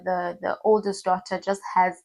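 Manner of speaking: ordinary delivery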